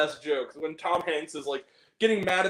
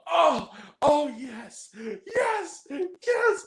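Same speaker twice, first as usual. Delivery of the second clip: high, rising mock moans of pleasure; imitating Meg Ryan's fake orgasm in the diner; 10 semitones higher, much slower than usual. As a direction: ham up a faked orgasm. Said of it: "like she's doing the orgasm".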